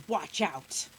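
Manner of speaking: loud, low, irritated warning; angry old man. A narrator's reading. Old man bumps into the boy, angry. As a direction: snap it at whoever bumped you. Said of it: "yelling at him".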